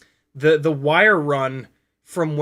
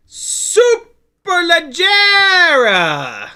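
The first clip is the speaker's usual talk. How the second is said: Superleggera belted out much higher, louder and very slow, Italian style; hammed-up Italian pronunciation; 14 semitones higher, 10 dB louder, much slower than usual. Shown as a demonstration. Naming the propellers, shouting Superleggera in a showy Italian accent. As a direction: stretch Superleggera with a big showy Italian flourish. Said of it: "I said it like an American with a proper R".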